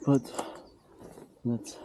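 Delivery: slow, breathless after the walk; panting between words; out of breath. Real, not acted. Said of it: "I'm out of breath".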